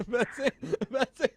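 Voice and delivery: high-pitched, giggly teasing question; laughing. Real, not acted. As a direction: barely get the question out through giggles. Host cracks up revealing the prank.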